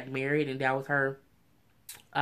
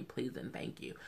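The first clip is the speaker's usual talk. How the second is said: whispering; 3 semitones lower, 15 dB quieter than usual. Real, not acted. Whispered aside after a request.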